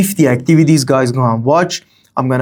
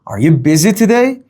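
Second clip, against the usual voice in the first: "Are you busy today" climbing higher to the end; rising intonation; 4 semitones higher than usual. Shown as a demonstration. Teacher demonstrating a yes-no question, rising intonation.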